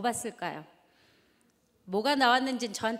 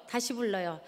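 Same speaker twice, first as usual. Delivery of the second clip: normal talking voice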